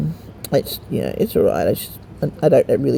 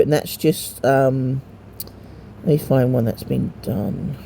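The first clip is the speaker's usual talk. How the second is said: normal talking voice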